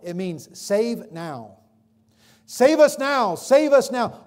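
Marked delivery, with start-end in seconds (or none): 2.5-4.2 high, loud plea; crowd crying out. Acted. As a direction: beg for rescue like the crowd. Crowd crying Hosanna meaning.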